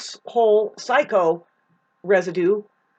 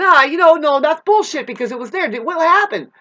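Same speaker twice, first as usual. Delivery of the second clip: much louder and higher, indignant outburst; raised angry voice; 7 semitones higher, 8 dB louder than usual. Shown as a demonstration. Showing how she sounds at code enforcement, loud and indignant. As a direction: let the voice climb and blast out. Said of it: "a voice like this that starts going".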